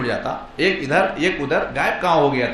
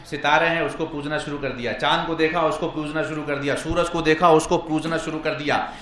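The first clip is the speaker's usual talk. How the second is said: ordinary talk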